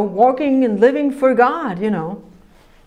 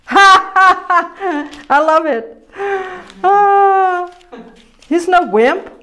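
louder, higher laughing joy; laughing delight; 7 semitones higher, 3 dB louder, much slower than usual. Real, not acted.

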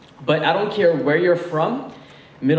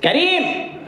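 put-on high call of his name, accented mom; 13 semitones higher than usual. Mom calling son Kareem, high accented call. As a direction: shout for your son across the house.

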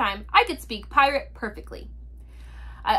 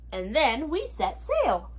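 ordinary delivery

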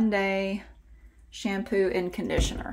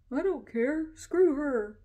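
higher, sulky refusal voice; grumpy pet voice; 7 semitones higher, slower than usual. Pretend. Voicing the dog refusing the cat, higher mock-grumpy. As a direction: sulk it out as the dog.

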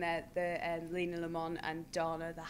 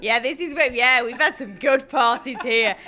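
loud, laughing showbiz swagger; mock party boast; 3 semitones higher, 12 dB louder than usual. Actress imitates cast arriving in Dublin, boasting loudly. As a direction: brag about the wild parties, laughing. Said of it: "you go".

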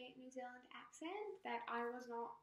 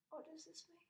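hushed; 3 semitones higher, 12 dB quieter than usual. Real, not acted. Panic stan mode, repeat.

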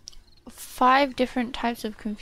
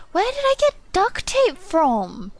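high, louder baffled question; puzzled surprise; 9 semitones higher, 5 dB louder than usual. Real, not acted. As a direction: ask it truly baffled. Puzzled at inventory item.

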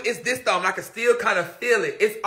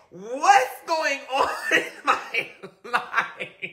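very slow, laughing through the words; slow laughing lament; 5 semitones higher, much slower than usual. Retold or imitated. She retells her panic, slow and laughing. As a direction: moan it while cracking up.

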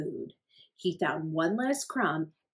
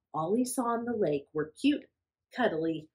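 plain reading voice

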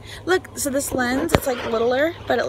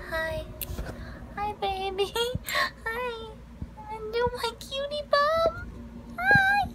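talking to a dog; 9 semitones higher, 8 dB quieter than usual. Real, not acted. Greeting dog in baby voice.

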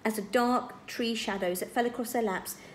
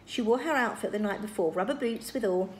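ordinary delivery, nothing marked

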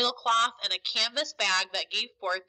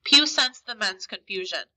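ordinary delivery, nothing marked

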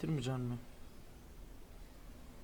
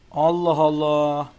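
slow, loud drawn-out Allah Allah; exasperated exclaim; 10 dB louder, much slower than usual. Real, not acted. Gamer loudly exasperated at his bad throws, slow and loud. Baffled by the race.

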